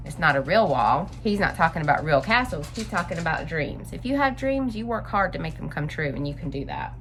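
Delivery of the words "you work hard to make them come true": ordinary delivery, nothing marked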